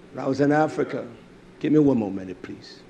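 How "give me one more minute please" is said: ordinary talk, nothing marked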